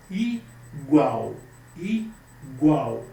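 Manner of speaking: "igual" split as "i... gual" with a clear pause; syllable by syllable. Shown as a demonstration. Teacher counting syllables for students, "igual" syllable by syllable. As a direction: say "igual" slowly in two beats, i, then gual. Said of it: "são duas sílabas".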